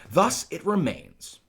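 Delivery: high, loud and drawn out; declaimed verse line. Acted. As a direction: declaim it slowly like stage Shakespeare. Reads the line, then explains it.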